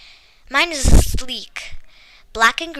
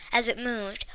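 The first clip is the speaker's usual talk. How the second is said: ordinary delivery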